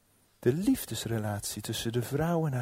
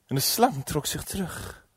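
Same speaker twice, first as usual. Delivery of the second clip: ordinary delivery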